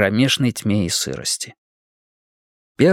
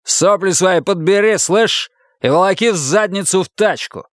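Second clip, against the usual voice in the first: raised, menacing command; rough order; 7 semitones higher than usual. A narrator's reading. Husband ordering his wife into the car, angry. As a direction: order her about with contempt.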